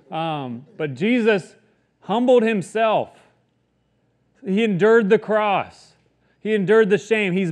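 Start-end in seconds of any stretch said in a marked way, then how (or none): none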